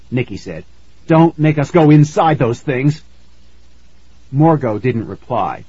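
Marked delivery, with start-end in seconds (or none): 1.1-3.0 frightened plea. Acted.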